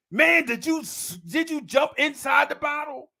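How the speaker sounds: high-pitched comic dad rant; exasperated father. Retold or imitated. Father grills six-year-old son over cologne, high mock exasperation. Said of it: "He'd be like".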